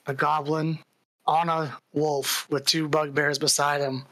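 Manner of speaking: ordinary talk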